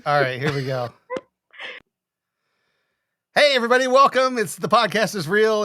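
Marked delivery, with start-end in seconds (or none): 3.3-5.6 high, loud host welcome; restarted show opening. Real, not acted. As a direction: launch the show afresh at full energy.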